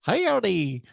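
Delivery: high, drawn-out mock Southern accent; hammed-up Southern accent of a small child. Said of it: "this accent. I didn't even sound Southern".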